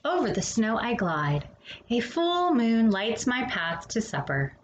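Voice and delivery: gentle bedtime picture-book read-aloud; storybook reading. Acted. Reading a winter picture book to children, gentle storytelling.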